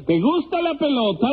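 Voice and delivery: very high-pitched mimicry of a question; high imitation of calling out; mimicked call. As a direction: echo the other man's hailing, way up high. Judge imitates the call to Simplicio.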